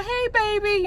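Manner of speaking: very high-pitched spousal hello; sweet greeting. Retold or imitated. Wife greeting husband on a video call, high and sweet. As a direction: imitate a wife's high, cooing greeting.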